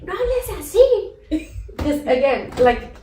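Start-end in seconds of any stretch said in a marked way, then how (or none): 0.0-1.5 high, slow mock scolding mother; scolding mom. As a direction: play the shocked mom, much higher and slower. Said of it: "She's like".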